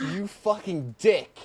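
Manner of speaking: playful fake outrage at a friend; a friend jokingly cursing out his buddy; mock insult.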